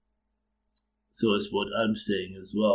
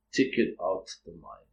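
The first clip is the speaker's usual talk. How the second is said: normal talking voice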